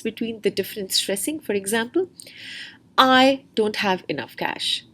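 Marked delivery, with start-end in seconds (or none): 3.0-4.8 "I" hit first and hard, slow delivery; stress on I. Shown as a demonstration. Teacher showing students stress on "I", slow and deliberate. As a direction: slowly lean on "I". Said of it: "The stress is on the person I".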